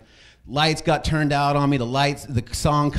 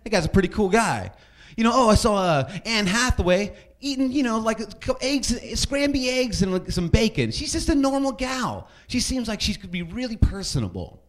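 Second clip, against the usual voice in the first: high, airy starstruck gush; gushing fan voice; 8 semitones higher than usual. Retold or imitated. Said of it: "Like, celebrity stories".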